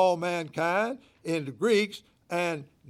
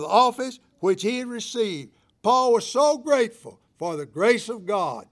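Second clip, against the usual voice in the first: loud, high, ringing pulpit preaching; preaching at full force; 7 semitones higher, 9 dB louder than usual. Acted. Pastor preaching obligation to his church, full-force loud delivery. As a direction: preach it out over the congregation.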